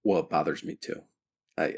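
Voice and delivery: usual speaking voice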